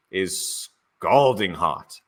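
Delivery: drawn-out, savoring each word; slow dramatic gossip. Acted. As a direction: spill the tea slowly. Spilling tea, drawn out.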